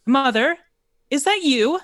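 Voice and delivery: high, loud woman's call; calling from upstairs. Acted. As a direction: call up a question to mother through the house. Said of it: "a woman's voice calling from upstairs".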